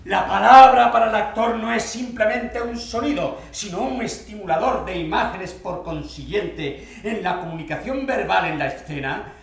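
loud, high, ringing declamation; declaimed reading aloud. Acted. Actor reading method theory to a Lorca-loving colleague, declaiming. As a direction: proclaim it like doctrine from the master's book. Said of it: "Leí esto a mi compañero".

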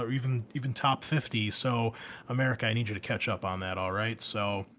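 ordinary delivery

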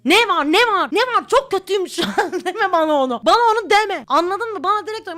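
freaking out, begging them to just say it; panicked shouting. Pretend. Hearing only her name, shouting back in laughing panic.

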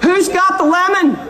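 calling out to the whole room; loud call to crowd. Real, not acted. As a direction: shout it across the room. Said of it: "shouted to audience".

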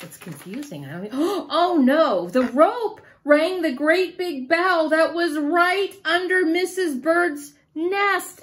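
ordinary delivery, nothing marked

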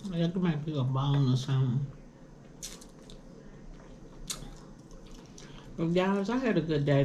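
eating and speaking at once; talking while chewing. Real, not acted. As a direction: mouth partly full of food. Seen 1: holds chicken piece at mouth, seems chewing while talking.

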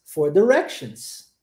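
"directions" drawn out slowly for repeating; slow teacher model of "directions". Shown as a demonstration. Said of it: "vamos tentar essa pronúncia".

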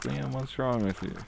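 high, exasperated teacher scolding Liam. Retold or imitated. Teacher scolds Liam for swearing, high and exasperated. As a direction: play the fed-up teacher, pitch jumping up. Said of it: "and she's like".

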